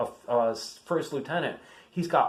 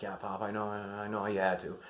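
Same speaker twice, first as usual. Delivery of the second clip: softer, retold giving-in; resigned reassurance; 2 semitones lower, 8 dB quieter than usual. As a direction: concede it quietly to grandpa.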